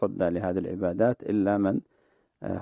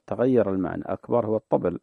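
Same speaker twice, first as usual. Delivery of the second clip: ordinary talk, nothing marked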